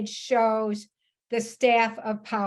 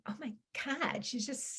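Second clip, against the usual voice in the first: hushed aside; 13 dB quieter than usual. Retold or imitated. Inner aside seeing her alone.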